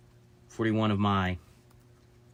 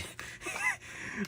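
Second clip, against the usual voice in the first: breathy laugh; 11 dB quieter than usual. Real, not acted. Laugh after it died.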